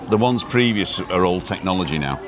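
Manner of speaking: usual speaking voice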